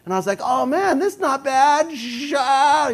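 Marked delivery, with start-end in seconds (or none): 0.4-2.0 high-pitched thrilled cry over the wind; mock exhilaration. Acts out thrill of freefall. 2.0-2.9 high, drawn-out rushing yell; imitated freefall roar.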